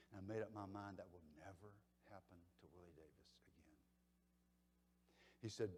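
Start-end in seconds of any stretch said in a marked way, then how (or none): 0.1-3.8 quoting a friend in a low whisper; whispered, quietly determined; whispered resolve. Retold or imitated. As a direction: hush it like a private oath. Retelling Willie's private resolve.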